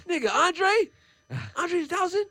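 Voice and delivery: high, loud startled shout of recognition; starstruck disbelief. Retold or imitated. Fan spotting a hero on the street, high surprised shout. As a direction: gasp out his name, can't believe it's him. Recognizing [name] in person.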